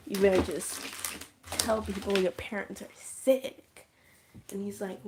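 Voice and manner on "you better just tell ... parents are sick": loud, forceful warning; acted threat. Aunt ordering kid to keep the secret, loud threat. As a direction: lean hard and loud on the order.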